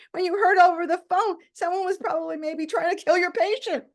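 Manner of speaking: high and loud, worked up; agitated doctor. Retold or imitated. Doctor telling his patient how shaken he was, agitated. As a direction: pour out the upset.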